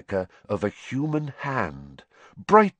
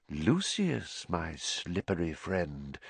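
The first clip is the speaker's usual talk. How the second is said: much softer, low hushed menace; whispered; 5 semitones lower, 10 dB quieter, slower than usual. A narrator's reading. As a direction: breathe it quietly at him. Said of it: "he whispered".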